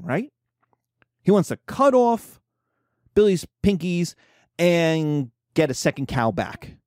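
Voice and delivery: ordinary delivery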